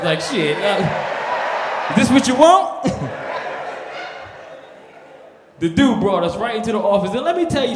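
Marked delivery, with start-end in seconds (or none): 0.2-2.9 startled, laughing it out loud; nervous laughing. Retold or imitated. Caught by the border guard, laughing nervously. 3.0-4.6 quiet airy laugh; breathy laugh. Real, not acted. Comedian laughing at his own story, soft breathy laugh.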